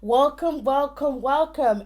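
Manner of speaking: excited welcomes. Real, not acted. Repeated loud welcomes.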